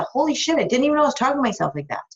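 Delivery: high, fast, stunned blurt of discovery; shocked realization. Pretend. Coach voicing a client who suddenly notices her self-talk, fast stunned surprise. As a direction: gasp it out as if just caught yourself. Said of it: "No, that's a".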